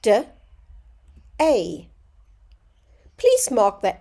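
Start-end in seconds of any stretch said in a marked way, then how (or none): 0.0-1.9 "D-A" split into two separate sounds; sounded out. Shown as a demonstration. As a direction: segment "D-A" sound by sound. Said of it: "Sound it out".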